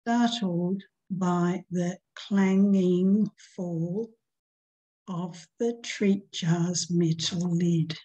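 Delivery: slow, measured poem reading; slow recital of a short poem. Shown as a demonstration. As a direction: read it out like a poem, unhurried.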